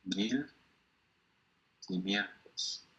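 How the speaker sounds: "mil ... quinientos", slowly with a long pause between; drawn-out demonstration. Shown as a demonstration. Teacher showing the Spanish number's length, slow and spaced. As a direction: softly stretch out "quinientos" after a separate "mil". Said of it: "En español decimos".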